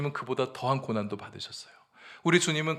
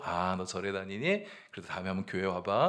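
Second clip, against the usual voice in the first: lightly voiced gentle invitation; 5 semitones lower, 5 dB quieter than usual. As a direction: coax a child gently, imitating a kind deaconess. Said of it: "부드럽게 얘기하셨다고".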